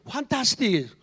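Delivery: ordinary talk, nothing marked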